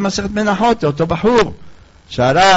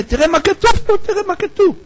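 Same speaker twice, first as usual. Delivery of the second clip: much higher, urgent pointing out; urgent showing; 13 semitones higher, faster than usual. Acted. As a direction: insist he look at the page. Weeping man points to book.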